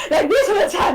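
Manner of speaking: high, strained mock yelling; imitating strained actor. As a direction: imitate an actor running out of breath. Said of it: "he was literally sounding".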